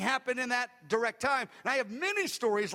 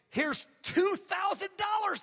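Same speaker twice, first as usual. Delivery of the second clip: much higher, slow, excited offer; eager giver; 9 semitones higher, slower than usual. Retold or imitated. Freed man eagerly offers the pastor money, high and slow. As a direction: hand over the money with excitement.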